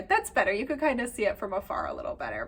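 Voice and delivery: normal talking voice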